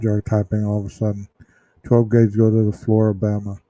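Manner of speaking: ordinary delivery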